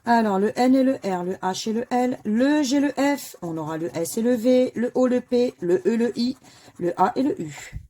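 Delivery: letter draw announcement. Acted. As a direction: announce every letter like a game-show draw. Calls out the drawn letters.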